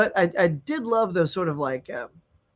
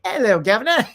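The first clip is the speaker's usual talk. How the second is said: mock British greeting, louder and higher; British accent; 4 semitones higher, 6 dB louder than usual. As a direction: put on a cheeky British accent. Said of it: "or slightly British".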